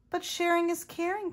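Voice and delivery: high-pitched storybook protest; acted, high-voiced plea; crying out. A narrator's reading. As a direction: cry it out high, as a young character objecting. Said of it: "cried Clark".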